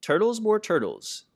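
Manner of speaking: bellowing. A narrator's reading. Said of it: "he bellowed and brayed".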